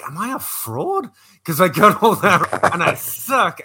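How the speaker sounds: high, slow, laughing at himself; mock self-doubt. Fantasy player mocks his own losing leagues, slow laughing self-doubt. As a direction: ask yourself if you're a fraud, half-laughing.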